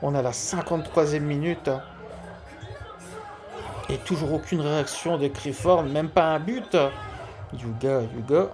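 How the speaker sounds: lively sports commentator calling a match; play-by-play commentary. Acted. Live football game commentary. (commentaire sportif en direct)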